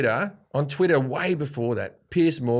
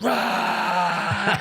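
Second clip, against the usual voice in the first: imitated roar of a crowd; 6 semitones higher than usual. Comic imitating the audience roaring back at him, crowd roar. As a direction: cheer like a whole audience, higher-pitched. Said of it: "And they went".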